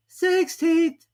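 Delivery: very high, sleepy-toned creature voice; a small creature chiming in; Dormouse. A narrator's reading. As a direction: Dormouse adding a date. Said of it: "added the Dormouse".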